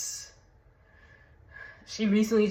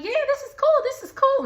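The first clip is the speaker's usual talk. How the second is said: put-on kids' voice; faster than usual. Kids answering dad.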